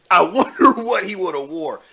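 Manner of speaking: amused, laugh breaking into the words. Real, not acted. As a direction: laugh through the wondering. Laughing while imagining the outfit.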